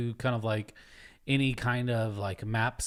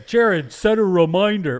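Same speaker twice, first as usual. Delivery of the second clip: barked order to an assistant; loud voice command; 7 semitones higher, 8 dB louder than usual. Retold or imitated. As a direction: call out a command loudly, higher. Mocking talking to a brain chip.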